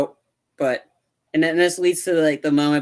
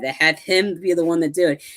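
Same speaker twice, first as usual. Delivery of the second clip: usual speaking voice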